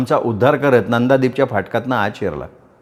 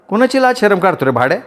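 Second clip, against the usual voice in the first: angry retort; 7 semitones higher than usual. Acted. Mother snaps back.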